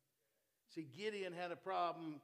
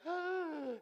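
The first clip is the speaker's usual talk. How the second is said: high startled gasp, louder; frightened gasp; 14 semitones higher, 8 dB louder than usual. Retold or imitated. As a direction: gasp like a scared Gideon.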